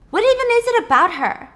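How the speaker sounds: high, loud demanding question; Sarah yelling at Peter about the double; loud frustrated. Acted. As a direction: snap it at him.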